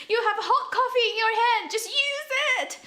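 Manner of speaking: very high, loud squealed urging; hammed-up shrieking plea to fight back; exasperated yelling. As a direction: scream at the screen, half laughing.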